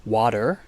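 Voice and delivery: "water" with a flapped T and an R at the end; American water. Shown as a demonstration. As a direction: say "water" like "wadder". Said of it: "with a flapped T and with an R".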